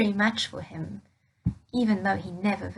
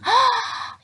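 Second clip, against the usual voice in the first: sharp, high gasp of delight; excited gasp; 13 semitones higher, 5 dB louder than usual. Acted. Father spotting his son, a high gasp. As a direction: gasp as if you spot him far off.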